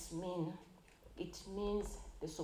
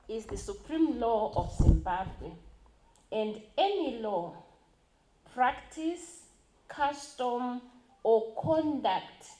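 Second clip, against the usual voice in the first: forceful reading; 3 semitones higher, 8 dB louder, slower than usual. Real, not acted.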